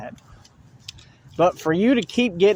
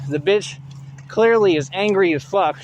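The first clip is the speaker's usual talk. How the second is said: ordinary delivery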